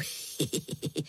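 low, acted chuckle; soft mischievous boy's laugh. A narrator's reading. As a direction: chuckle quietly at your own joke. Boy laughs at his joke.

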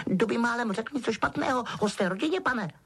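high-pitched elf voice; Dobby explaining. A narrator's reading. Elf explaining his punishment to Harry, squeaky. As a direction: explain it like a dutiful little servant.

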